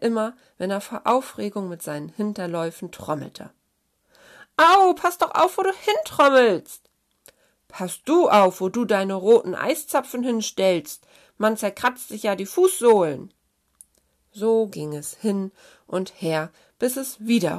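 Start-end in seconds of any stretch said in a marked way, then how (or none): 4.6-6.9 high, loud squabbling outburst; indignant storybook hare-and-hen quarrel; angry scolding. A narrator's reading. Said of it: "Dann ging das Geschimpfe los". 7.7-13.3 loud, indignant retort; punchy, peeved comeback; scolding back. A narrator's reading. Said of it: "Dann ging das Geschimpfe los".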